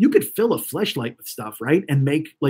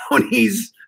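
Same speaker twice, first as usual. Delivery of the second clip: ordinary delivery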